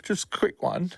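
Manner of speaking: high, hesitant, frightened voice; nervous, close to crying; scared, near tears. Acted. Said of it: "in the manner of a deeply scared, paranoid person who is on the edge of possibly tears".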